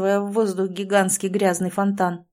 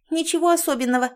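fast, high fussy squeak; flustered; 8 semitones higher, faster than usual. A narrator's reading. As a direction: brush it off in a hurry. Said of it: "засуетилась мышка".

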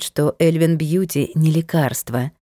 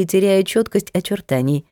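usual speaking voice

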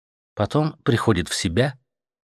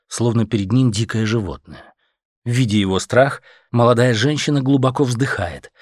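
ordinary delivery, nothing marked

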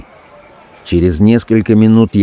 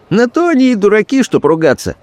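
cheerful; 13 semitones higher, faster than usual. A narrator's reading. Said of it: "весело подтвердил мой спутник".

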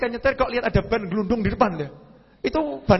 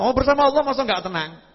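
usual speaking voice

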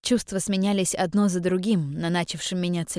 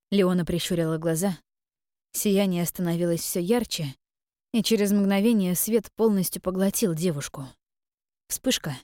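plain reading voice

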